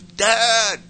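high, loud cry for Dad; reenacted child's yell to a father. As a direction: blurt it out as a yell. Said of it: "I yelled at him".

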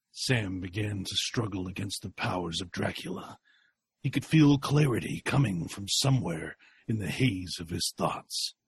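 soft, low storyteller; tense narration. A narrator's reading. Narrator telling Sam's struggle, hushed. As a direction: hush the struggle.

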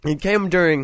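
talking around a piece of candy; chewing while talking. Real, not acted. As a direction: mouth full of candy. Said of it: "excuse me, I'm trying to eat this candy".

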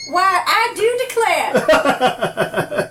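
Southern belle. Pretend. Mock southern belle line.

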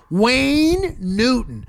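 slow, drawn-out name; slow, savouring repeat. Real, not acted. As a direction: stretch the name out for effect.

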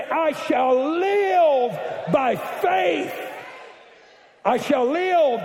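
slow, high, loud shout of faith; shouted declaration. Shown as a demonstration. Congregation shouts with the preacher, declaring loudly, slowly. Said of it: "and say".

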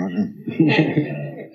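slow, drawn out through a laugh. Real, not acted. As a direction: tease him while chuckling. Teasing host about cutting him off.

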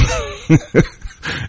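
airy high laugh; breathy laugh. Real, not acted. Laughing at own story.

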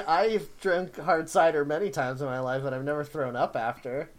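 ordinary delivery

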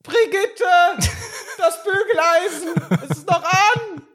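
put-on high, laughing senile old man. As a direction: squeak it like a confused grandpa.

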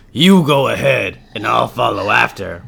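gruffalo eyeing its meal; hungry gruffalo. Acted.

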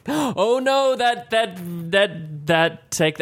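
high-pitched dismay, stumbling over words; gasp, then backpedal in alarm. Real, not acted. Retracting a botched accent, high dismayed stammer.

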